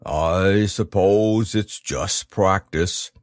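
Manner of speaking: low, slow, ponderous bear; a slow bear musing to himself; thoughtful bear voice. A narrator's reading. Pooh thinking aloud.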